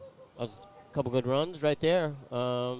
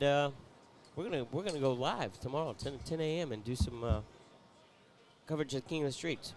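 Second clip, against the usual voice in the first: ordinary talk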